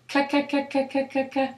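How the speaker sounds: rhythmic "K" syllables for a speech-rate test; repeated K sound. Shown as a demonstration.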